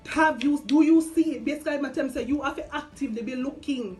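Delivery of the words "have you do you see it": louder challenging question; lightly voiced God, raised and insistent; emphatic God voice. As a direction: press it like God demanding an answer.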